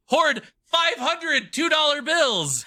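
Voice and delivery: high, slow disbelieving yell; can't believe what he's hearing; incredulous shout. Real, not acted. Incredulous teasing question.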